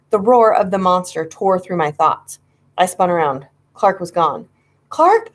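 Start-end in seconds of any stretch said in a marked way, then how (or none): 4.9-5.3 high, loud call of his name; shout. A narrator's reading. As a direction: shout for Clark across the house. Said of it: "I shouted".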